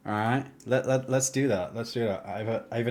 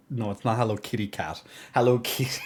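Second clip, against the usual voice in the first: usual speaking voice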